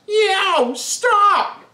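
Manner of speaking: put-on high, sharp yelp of a spanked kid; pained kid yelp. Kid Jerry yelping while the ghost spanks him, high pained yelp. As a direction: squeal it out like a child getting spanked.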